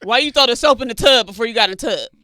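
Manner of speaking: scolding parent. Retold or imitated. Strict parent questions kid about the soap, mock scolding.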